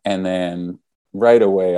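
normal talking voice

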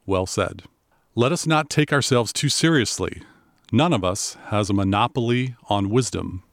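ordinary delivery

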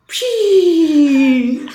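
high, breathy pshi snore sound; french sleep noise. Shown as a demonstration. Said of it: "I would assume it sounds like".

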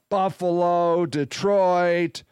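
ordinary talk

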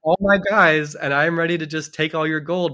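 hammed-up bad king, loud then deflating; grumbling bad king. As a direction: sulk like a foiled villain. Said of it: "the bad king is like".

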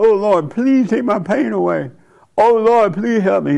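high, mock-desperate plea to the Lord; pleading prayer. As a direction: imitate someone begging God for relief. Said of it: "you pray to the Lord".

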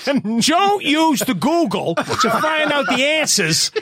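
high, slow, laughing announcement; mock a stern quiz host; strict quiz host. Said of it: "one woman on a microphone who was like".

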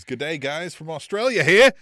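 badly faked Australian accent with a loud finish; terrible Australian accent. Host reading a chat greeting in a bad Australian accent. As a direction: read it like a mock Aussie. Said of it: "forgive me for my terrible Australian accent".

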